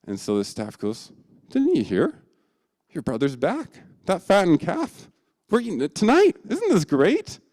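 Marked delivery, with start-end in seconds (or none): 1.5-7.4 much higher and louder, bubbly; excited to spill the news; gushing servant. Acted. Servant gushing to the older brother, higher and louder.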